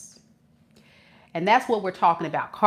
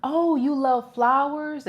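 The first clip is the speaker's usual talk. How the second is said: mock charmer; 6 semitones higher, slower than usual. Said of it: "when they say".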